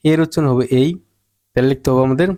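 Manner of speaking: ordinary talk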